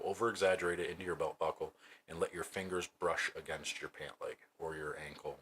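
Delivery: ordinary talk, nothing marked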